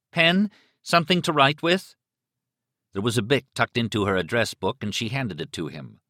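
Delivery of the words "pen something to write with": urgent, raised plea; pleading. A narrator's reading. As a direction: beg for a pen. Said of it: "he pleaded".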